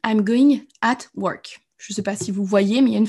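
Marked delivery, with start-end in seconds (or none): 0.1-1.7 slow, careful English; spelled-out example sentence; example sentence read slowly. Shown as a demonstration. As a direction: read it out deliberately, word by word. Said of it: "je vous ai mis".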